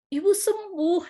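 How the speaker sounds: mildly amazed doctor, voice lifted; retold astonished doctor. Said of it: "dokter sempat kaget gitu".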